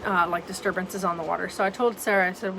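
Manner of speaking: ordinary talk, nothing marked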